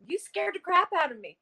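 startled. Retold or imitated. Jenny scolding Mandy after being frightened, startled. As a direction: retell it as if just given a fright. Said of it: "she was just really startled".

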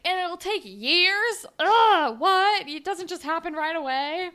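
high, loud, slowed outrage; hammed-up dismayed Riley; mock dismay. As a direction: whine at the bad news. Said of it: "he's like".

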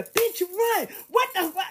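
high startled outburst; shocked. Retold or imitated. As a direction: blurt it out in shock. Shocked reaction in theater.

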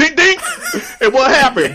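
laughing. Real, not acted. Mocking Brock laughing.

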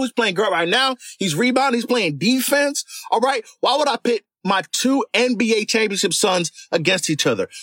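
usual speaking voice

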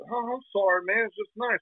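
high, apologetic mock Florida accent. Florida guy apologizes to Jersey man, mock Florida accent. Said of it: "I don't know what kind of accent that is".

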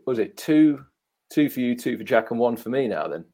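usual speaking voice

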